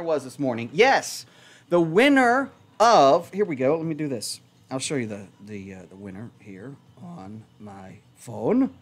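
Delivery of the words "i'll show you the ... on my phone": odd low voice. Real, not acted. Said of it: "I don't know why I'm talking like this".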